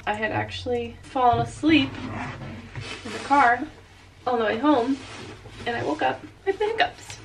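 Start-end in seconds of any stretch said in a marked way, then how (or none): none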